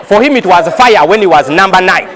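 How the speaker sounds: ordinary delivery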